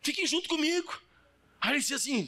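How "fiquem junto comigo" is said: Joseph calling brothers. Retold or imitated. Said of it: "E disse José aos seus irmãos".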